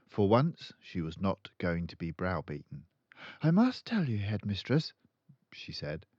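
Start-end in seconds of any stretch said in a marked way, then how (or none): 3.4-4.9 put-on high, polite young teacher; high pleading teacher; timid teacher. A narrator's reading. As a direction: stand firm though nervous. Miss Honey speaks firmly.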